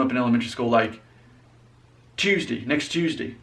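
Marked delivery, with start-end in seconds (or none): 2.1-3.3 "Tuesday" as CHOOZ-dee; Southern pronunciation. Shown as a demonstration. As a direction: start "Tuesday" with a CH sound. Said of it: "pronounce it the Southern way".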